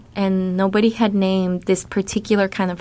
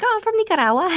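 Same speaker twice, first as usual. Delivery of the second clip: high, breezy and carefree; easy and proud; 13 semitones higher, faster than usual. Retold or imitated. Answering an alumnus's question, high and breezy. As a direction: toss it off lightly, proud of it. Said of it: "very easily just like".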